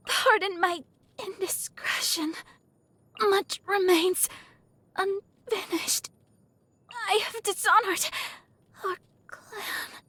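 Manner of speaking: high, slow, fading and broken; dying words. Acted. Fallen lady of the clan in defeat, high faltering last words. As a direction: falter through it as strength gives out, ending near tears.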